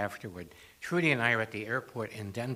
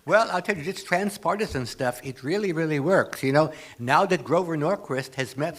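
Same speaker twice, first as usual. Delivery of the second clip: raised, bright-eyed guru voice, louder; a swami pitching to a room of activists, in a higher, louder guru voice; 4 semitones higher, 5 dB louder than usual. Retold or imitated. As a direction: play the beaming swami selling transpartisan wisdom. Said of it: "I have to do comedy for this, right, as a swami. So I go".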